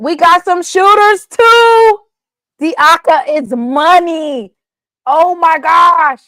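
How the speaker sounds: high, loud, slow and drawn-out boast; hyped brag. Real, not acted. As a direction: stretch each word out, bragging hard. Hyping own team's shooters.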